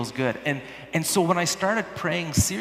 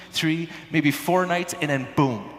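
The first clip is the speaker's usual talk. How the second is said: usual speaking voice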